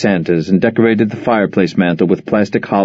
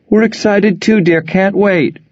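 much higher, bright surprised mother; delighted phone greeting; 10 semitones higher, slower than usual. Acted. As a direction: greet your daughter warmly on the phone.

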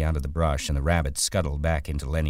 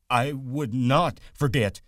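higher, louder and slow protest; Lenny loudly insisting to the rabbit, higher and slow; 10 semitones higher, 5 dB louder, slower than usual. A narrator's reading. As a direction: insist with all his might. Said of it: "Lenny said loudly".

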